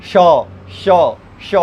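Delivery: श repeated, tongue curled back; sound demo. Shown as a demonstration. Teacher demonstrates retroflex श sound. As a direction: hold श with the tongue turned up. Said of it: "जैसे कि श".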